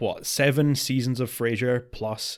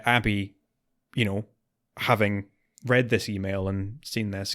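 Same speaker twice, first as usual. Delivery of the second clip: usual speaking voice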